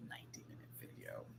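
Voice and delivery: unvoiced disbelieving whisper; stunned whisper to yourself. Real, not acted. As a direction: whisper it in shock.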